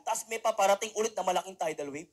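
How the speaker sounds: ordinary delivery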